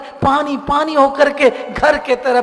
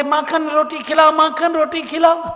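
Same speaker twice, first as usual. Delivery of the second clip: put-on higher whiny child begging; childish pleading; 6 semitones higher, 4 dB louder than usual. Child Krishna begging mother for food, whiny. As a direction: beg like a small child tugging the sari.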